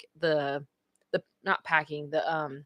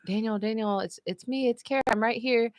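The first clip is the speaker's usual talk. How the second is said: gentle reassurance; 3 semitones higher, 5 dB quieter than usual. Retold or imitated. Wife comes over to her groggy husband, gentle reassurance. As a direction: gently let him know you are there.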